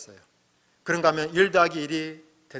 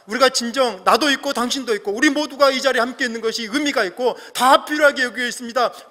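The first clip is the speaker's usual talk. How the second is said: higher, louder preaching build; impassioned preaching; 7 semitones higher, 7 dB louder than usual. Acted. Pastor rousing the congregation, loud and high. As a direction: drive the sermon to its peak.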